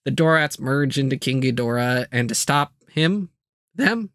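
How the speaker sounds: normal talking voice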